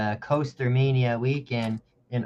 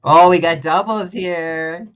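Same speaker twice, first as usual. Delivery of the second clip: loud, drawn-out reaction to a surprise; real, amused call-out of a discovery; playful surprise; 5 semitones higher, 10 dB louder, slower than usual. Real, not acted. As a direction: raise the pitch and stretch it out loudly. Host discovers two artists drawing Hobbs.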